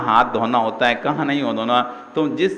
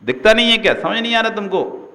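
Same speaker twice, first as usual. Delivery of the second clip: high, loud scornful yell; angry yell; 7 semitones higher, 4 dB louder, faster than usual. Retold or imitated. Sadhu scolds the careless newcomer, loud and angry. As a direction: bark it at him in contempt. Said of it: "ऐसे चिल्ला देते थे".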